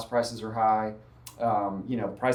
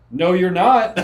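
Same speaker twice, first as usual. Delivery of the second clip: loud, higher-pitched mock refusal; retold sharp rebuff; 7 semitones higher, 11 dB louder, slower than usual. Occupant rebuffing an agent who walked in, mock stern. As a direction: shut the visitor down, half laughing.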